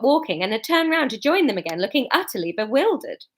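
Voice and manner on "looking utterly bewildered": usual speaking voice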